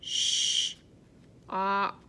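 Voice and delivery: Sh, op in two parts; sounding out words. Shown as a demonstration. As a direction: split onset from rime. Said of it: "start with our sound".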